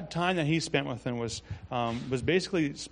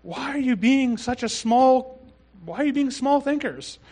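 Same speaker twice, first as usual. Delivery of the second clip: high, drawn-out exasperated questioning; worked-up frustration; 10 semitones higher, 5 dB louder than usual. Retold or imitated. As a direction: act out an incredulous, worked-up scolding. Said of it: "getting a little worked up".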